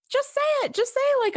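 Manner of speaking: high, pleading push; begging him to just say it; exasperated urging. Real, not acted. Fan urging Riker to admit his feelings, high and insistent.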